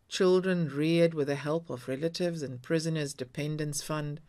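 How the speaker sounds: plain reading voice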